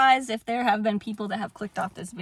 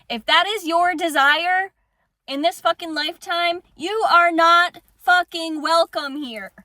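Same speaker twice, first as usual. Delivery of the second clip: loud, higher furious yelling, real rage; furious shout; 5 semitones higher, 5 dB louder than usual. Real, not acted. Vlogger yelling at manipulative people watching her, furious shout. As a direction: throw them out, shouting. Ordering abusers out, vowing they'll burn.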